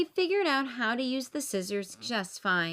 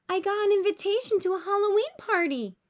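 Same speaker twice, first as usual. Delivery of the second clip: put-on high, loud and fast excited boy; bursting with the news; thrilled high exclamation; exclaiming; 9 semitones higher, 6 dB louder, faster than usual. A narrator's reading. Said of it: "he exclaims".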